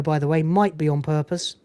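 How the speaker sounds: ordinary talk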